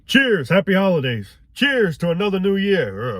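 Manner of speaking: mock cheerful toast. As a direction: raise a glass you don't believe in. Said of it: "makes me wanna barf just saying that".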